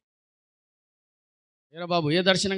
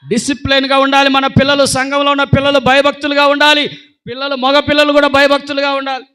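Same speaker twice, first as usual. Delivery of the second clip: higher, louder preaching push; preaching at full force; 8 semitones higher, 10 dB louder than usual. Acted. Preacher exhorting the church's youth, high and loud. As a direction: drive it home to the young ones.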